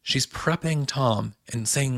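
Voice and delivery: normal talking voice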